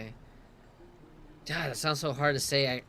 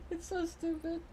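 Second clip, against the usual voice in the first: giggling; 6 dB quieter than usual. Real, not acted. Giggling at own slip-up.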